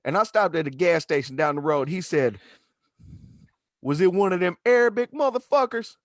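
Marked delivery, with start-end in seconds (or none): 3.8-5.9 a bit higher, knowing tone; knowing local. Retold or imitated. Stranger outside the gas station asking, lightly voiced. Man at second station asks.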